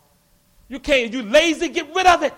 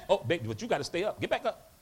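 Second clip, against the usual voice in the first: normal talking voice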